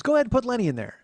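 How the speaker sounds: high, loud imitated aunt; bossy aunt. Aunt telling nephew whom to add, bossy aunt voice. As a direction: boss him around as the aunt.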